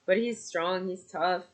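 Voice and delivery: ordinary delivery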